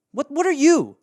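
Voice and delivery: ordinary talk, nothing marked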